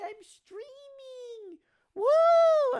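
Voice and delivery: squealing high cheer; excited. Real, not acted. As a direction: whoop it up with joy. Celebrating return to streaming.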